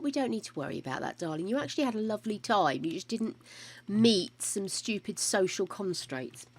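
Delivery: usual speaking voice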